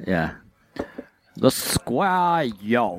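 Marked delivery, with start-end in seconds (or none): 1.4-3.0 put-on "Square Yolk" stretched very slowly in an odd accent; weird accent. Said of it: "saying it with a weird accent".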